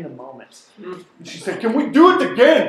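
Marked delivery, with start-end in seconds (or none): 1.6-2.7 excited woman. Retold or imitated. Gladys eager after near drowning.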